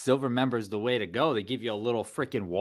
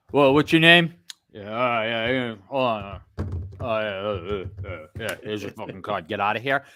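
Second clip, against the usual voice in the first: bored mechanical politeness; 4 semitones lower than usual. Retold or imitated. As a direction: drone it like a bored clerk. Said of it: "instead of you coming in and they go".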